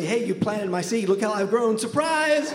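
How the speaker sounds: high mock-cheery card message; greeting-card voice. A card greeting an estranged father on Father's Day, mock-cheery. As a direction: build to a high, gleeful punchline shout. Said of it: "it would probably say".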